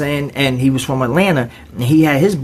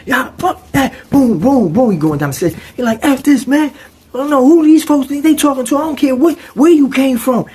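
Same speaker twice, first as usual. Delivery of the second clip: high, louder, heated rant; mock yelling; 13 semitones higher, 4 dB louder than usual. Amped roommate yelling at the dorm, high and loud. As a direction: go off, fired up.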